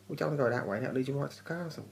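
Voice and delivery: ranting vlogger imitating a worm's gleeful gotcha; mock sneaky worm. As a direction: crow it like the tricksy Labyrinth worm. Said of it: "she goes".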